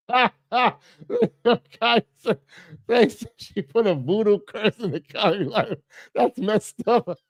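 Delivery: real laughter running through the words; laughing. Real, not acted. Host reading a curse in the chat, cracking up. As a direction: talk through a laughing fit.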